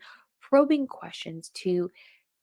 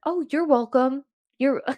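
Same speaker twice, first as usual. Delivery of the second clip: pointed you're welcome; 5 semitones higher, 5 dB louder than usual. Retold or imitated. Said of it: "I wanted to be like".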